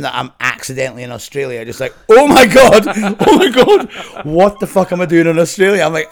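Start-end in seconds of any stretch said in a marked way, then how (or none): 2.1-5.8 loud, high-pitched exclamation breaking into laughter; retold panicked disbelief. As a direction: relive the shock, laughing at yourself. Retelling his panicked realization.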